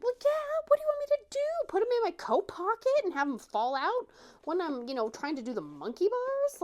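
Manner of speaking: put-on very high, loud, fast exasperated kid; exasperated yell. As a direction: shriek back at mom in frustration. Child yells back at mom.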